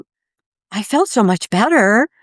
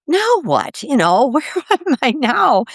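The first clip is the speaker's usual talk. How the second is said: bewildered; 7 semitones higher than usual. Retold or imitated. Retold bewilderment after waking.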